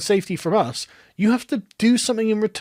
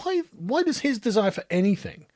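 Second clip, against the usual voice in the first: usual speaking voice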